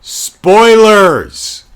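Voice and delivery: loud, high, drawn-out announcement; called-out warning. Real, not acted. Host warning viewers of the ending, called-out warning. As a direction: sing out the warning at volume.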